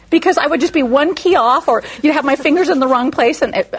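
usual speaking voice

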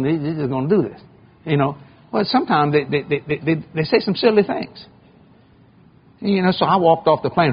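normal talking voice

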